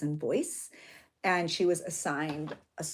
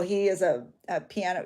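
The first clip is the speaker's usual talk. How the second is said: ordinary talk, nothing marked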